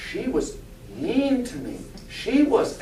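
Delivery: mock Southern lady's grievance; thick Southern accent. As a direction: complain in an imitated Southern accent. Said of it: "she has a very thick Southern accent".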